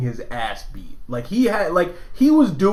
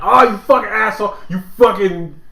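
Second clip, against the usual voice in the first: mock gamer rage, louder and higher; imitated yelling; 5 semitones higher, 5 dB louder than usual. Showing how Joe normally yells while playing, mock angry yell. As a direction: imitate a friend cursing loudly at his game. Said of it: "normally Joe is sitting here yelling at shit".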